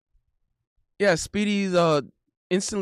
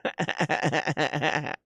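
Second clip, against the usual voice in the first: lower, rattling run of laughter; long chuckle; 5 semitones lower, 5 dB quieter than usual. Real, not acted. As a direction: keep laughing helplessly. Cracks up at his own bit.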